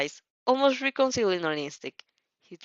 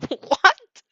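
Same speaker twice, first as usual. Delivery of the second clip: high, loud startled yelp; alarmed squeak of disbelief; panicked what; 11 semitones higher, 7 dB louder than usual. Acted. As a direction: blurt it out in sudden panic. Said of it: "You're nothing short of panic".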